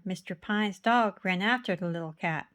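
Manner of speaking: ordinary delivery, nothing marked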